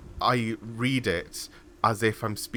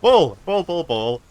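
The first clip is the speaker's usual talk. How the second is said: loud, high burst of repeated words; mock dog-like excitement, high and loud; playful excited outburst; 7 semitones higher, 12 dB louder than usual.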